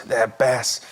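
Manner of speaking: put-on low, slow, halting upset kid; mock tearful child. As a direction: imitate a little boy fighting back tears. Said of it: "He starts to cry".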